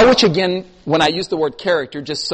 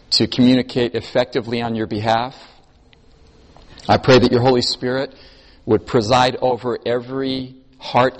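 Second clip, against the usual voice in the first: opening prayer; 4 semitones lower than usual. Real, not acted. Preacher praying over the congregation before teaching, reverent prayer. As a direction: pray in measured phrases, settling lower toward the amen.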